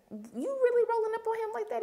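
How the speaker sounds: high, disbelieving question; incredulous. Retold or imitated. Reacting to Roller threatening Doctor Greg, high incredulous. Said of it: "I was just like".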